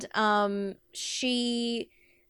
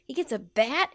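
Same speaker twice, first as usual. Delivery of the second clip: usual speaking voice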